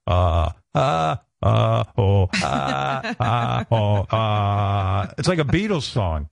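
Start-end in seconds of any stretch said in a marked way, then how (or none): 0.1-5.1 mock fry moaning.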